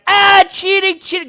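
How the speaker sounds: high, loud mock cry of cheating; mock Trump. Trump crying foul over the count, high mock whine. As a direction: whine cheating like a sore loser. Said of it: "Trump is gonna be like".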